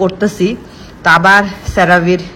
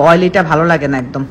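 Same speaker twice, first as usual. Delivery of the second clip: ordinary talk, nothing marked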